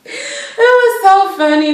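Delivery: high, slow, drawn-out delight; tickled amusement. Real, not acted. Recapper recalls the scene, high and tickled. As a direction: say it while coming down from giggles. Recovering from a laughing fit.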